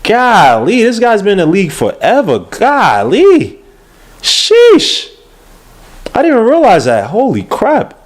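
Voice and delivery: high-pitched, genuine disbelief; astonished. Real, not acted. Host discovering how long the player has played, astonished. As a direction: exclaim in real amazement, pitch jumping up.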